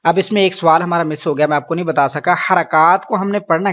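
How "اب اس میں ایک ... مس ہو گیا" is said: normal talking voice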